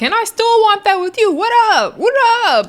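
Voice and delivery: high-pitched confrontational shouting; Summer. Retold or imitated. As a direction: mimic a rowdy castmate picking a fight. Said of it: "you just hear Summer like".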